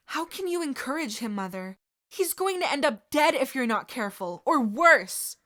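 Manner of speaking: high, loud desperate yell. Acted. Sirius yelling at his mother, high and loud. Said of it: "Sirius yelled".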